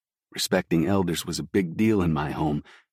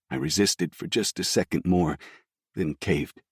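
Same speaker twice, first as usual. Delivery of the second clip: usual speaking voice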